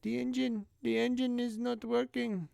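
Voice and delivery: a light, high mock Indian accent. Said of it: "she sounded kinda like Indian".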